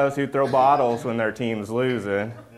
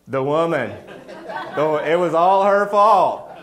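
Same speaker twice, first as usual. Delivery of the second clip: loud, laughing excuse from Adam; higher, louder guilty laugh pinning it on the woman; Adam blaming, laughing; 4 semitones higher, 6 dB louder than usual. Acted. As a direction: shove the blame off with a laugh. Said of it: "He goes".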